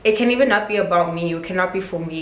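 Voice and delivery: ordinary talk, nothing marked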